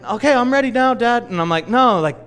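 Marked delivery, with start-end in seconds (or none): 0.0-1.2 high, loud imitation of an impatient son; son's voice. Son ready to go, calling to Dad, imitated son's voice. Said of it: "that's how my son talks". 1.7-2.3 higher, louder protest, acted out; protesting dad. Dad holding back his eager son, acted protest.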